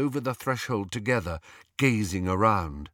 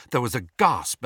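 usual speaking voice

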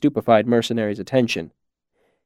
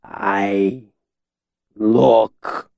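befuddled, one word at a time; halting, thick-tongued daze; slow, thick voice; 2 semitones lower than usual. A narrator's reading. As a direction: drag out each word and trail off. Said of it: "his voice slow and thick".

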